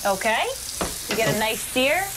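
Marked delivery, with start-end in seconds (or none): none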